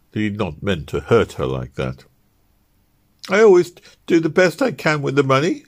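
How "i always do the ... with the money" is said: higher, pleading voice on the edge of tears; tearful wife. Acted. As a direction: defend yourself through tears, voice pitched up. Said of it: "Ruth sobbed".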